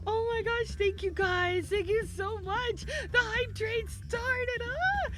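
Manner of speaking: high, stretched-out squeal of thanks; excited. Real, not acted. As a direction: gush with excitement, pitch way up. Hype train starts.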